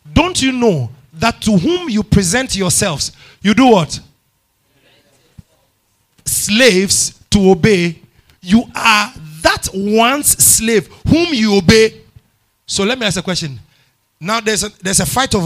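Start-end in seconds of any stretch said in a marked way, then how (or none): 0.1-12.0 loud, slow preacher's emphasis; preaching. Acted. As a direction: preach it out with full force. Preaching at full force.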